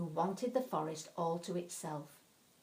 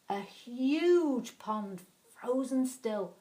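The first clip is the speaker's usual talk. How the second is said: awed; 4 semitones higher, 7 dB louder, much slower than usual. A narrator's reading. Wonder at the pond.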